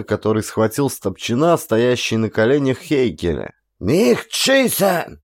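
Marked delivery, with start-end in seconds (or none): 3.8-5.2 much higher, drawn-out German cry; yelled plea. A narrator's reading. German soldier begs not to be shot, yelling. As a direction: scream it with hands raised. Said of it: "заорал второй немец".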